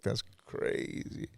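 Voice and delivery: a hushed, disbelieving aside; slow stunned whisper, almost no voice. Retold or imitated. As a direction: breathe it out, barely voiced and slow. Stunned reaction to police news.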